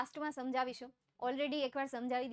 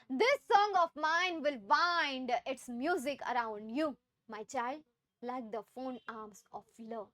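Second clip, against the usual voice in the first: slow poem recitation; 3 semitones lower, much slower than usual. Acted. Teacher reading the poem to her class, slow recitation. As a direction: read the poem line slowly and deliberately.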